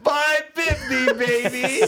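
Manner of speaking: high, slow, triumphant call; gleeful cheer. Real, not acted. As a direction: crow over the cheap fine. Celebrating the low fine.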